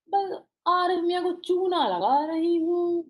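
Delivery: imitating a measured, unhurried poet's reading; mock-slow delivery with long pauses; lightly voiced elderly poet reading a line; Gulzar's slow style. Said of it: "जैसे गुलजार साहब पढ़ते हैं, उनका style है ये".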